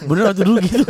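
laughing. Real, not acted. Laughing recall of mirror fantasy.

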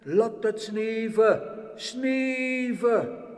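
loud, slow drawn-out call; calling from heaven. Acted. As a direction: stretch the words like a command from above. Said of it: "van boven riepen ze".

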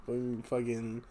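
normal talking voice

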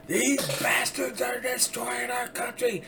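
high, slow mock-rant voice; imitating Mark Levin ranting. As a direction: ham up a talk-radio rant. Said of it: "He'll go, get going on these rants".

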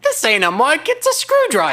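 put-on high laughing David Tennant accent; a squeaky, giggling David Tennant accent; mock David Tennant accent. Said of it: "That's not what he sounds like".

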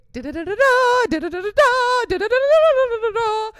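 very high, loud rapid slot-machine trill; imitated jackpot jingle. What she hears seeing a Ferrari, loud jackpot noise. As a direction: ring out like a Vegas jackpot. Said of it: "That's my jackpot".

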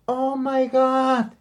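high, slow, drawn-out gasp of shock; mock stunned girlfriend, stretched out. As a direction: play her stunned reaction, high and slow. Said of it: "le hace".

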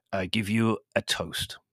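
slow, ceremonious manservant; solemn. A narrator's reading. Servant proposing toast to guest, solemn. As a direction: propose it gravely, word by word. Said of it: "he said to me solemnly".

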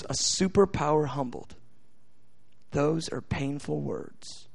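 low, hushed news anchor imitation; grave anchor. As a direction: quote him gravely, low and slowing down. Retells news anchor's grave remark.

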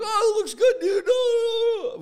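loud, squealed mock delight; hammed-up fool, very high and loud; clueless idiot. Homeowner praising a bad paint job, like an idiot. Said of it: "Like a fucking idiot".

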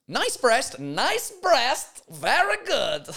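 high, swooping comic voice; mock leering approval. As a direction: savour the pun in a silly high voice. Savouring the rude pun.

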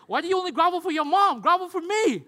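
high, loud comic complaint; mock indignant dad. Dad demanding son grovel for him too, mock outraged. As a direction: protest loudly, like a jealous parent.

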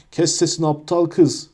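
plain reading voice

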